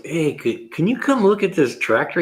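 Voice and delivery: high-pitched imitation of a coworker's request; puzzled coworker. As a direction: lift the voice, imitating someone asking for help. Retells puzzled coworker asking help.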